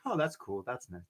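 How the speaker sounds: soft, understated reaction; quiet, dropping feigned indifference; mock nonchalance. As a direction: play it down, barely bothered. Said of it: "I was just a tiny, I, I was like".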